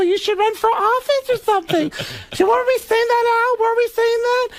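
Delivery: high, gushing squeal of a starstruck woman; clueless, over-excited falsetto chatter; Beverly D'Angelo. Retold or imitated. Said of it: "gets off the elevator and goes".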